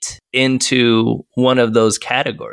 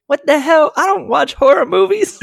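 much higher, incredulous outburst; mock outrage. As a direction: retell the shock of a horror pick, pitched way up. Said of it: "I was like".

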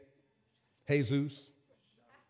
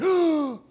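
sharp gasp; 7 semitones higher than usual. Retold or imitated. Retold fright.

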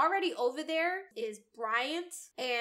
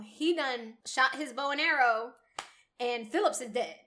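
ordinary delivery

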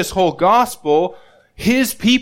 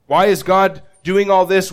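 usual speaking voice

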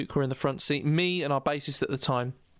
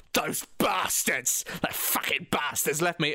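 acted indignation; 2 semitones lower, slower than usual. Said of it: "and I go".

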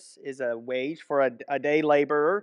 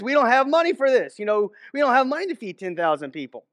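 exasperated, higher and louder; imitating Philip, fed up and a bit louder; 6 semitones higher, 3 dB louder than usual. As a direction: push back like a stressed disciple who can't pay. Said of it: "the tone of voice that".

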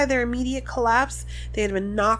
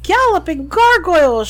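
louder, higher startled shout; 6 semitones higher, 10 dB louder than usual. A narrator's reading. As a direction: blurt it out in shock. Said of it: "shouted Professor Tofty".

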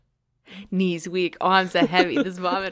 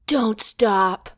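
louder, higher dragon plea; 4 semitones higher, 7 dB louder than usual. Acted. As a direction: call it out as the dragon. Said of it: "This dragon voice is too much".

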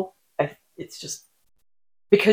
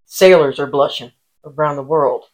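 ordinary talk, nothing marked

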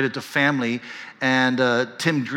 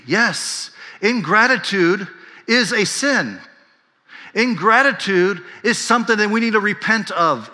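higher, louder pulpit voice; preaching; 8 semitones higher, 5 dB louder than usual. Acted. Preacher calling ingratitude a sin, rising fervor. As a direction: lift it into preaching mode.

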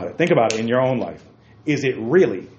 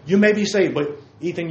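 ordinary talk

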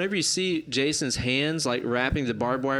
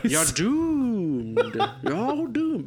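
ominous doom warning; 8 semitones higher, much slower than usual. Retold or imitated. Imitating Crazy Ralph's warning.

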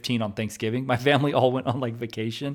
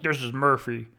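fake voice. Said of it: "a fake name and a fake voice".

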